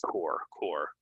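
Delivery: "Corps" said like "core"; silent P and S. Shown as a demonstration. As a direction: end "Corps" on the r sound. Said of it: "S actually as well".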